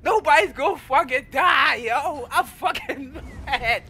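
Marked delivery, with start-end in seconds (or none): 2.3-3.8 slow, exasperated words broken by a laugh; angry laughing. Real, not acted. Player fuming over damage nerfs, angry but laughing. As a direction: laugh through the frustration, dragging it out. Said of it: "I'm angry and I'm laughing at the same time".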